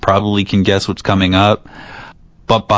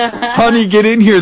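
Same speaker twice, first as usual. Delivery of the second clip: calling out; 11 semitones higher than usual. Pretend. Calling spouse into room.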